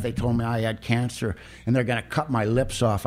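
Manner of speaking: usual speaking voice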